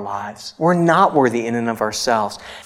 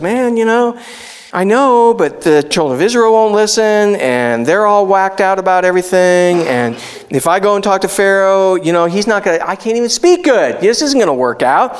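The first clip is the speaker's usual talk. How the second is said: whiny protest; 6 semitones higher than usual. Retold or imitated. Moses pleading with the Lord that he can't speak, whiny high protest. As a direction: protest like a reluctant man out of his depth. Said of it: "That's what he's crying about again".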